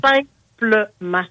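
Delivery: slow, stretched out simplement; drawn out slowly. Real, not acted. Drawn-out emphatic repeat of the word.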